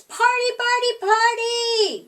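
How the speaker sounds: high, loud chant of party; excited shout. Acted. Little possums jumping and cheering about the party, high excited shout. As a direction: cheer it out, bouncing with glee. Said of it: "they shouted".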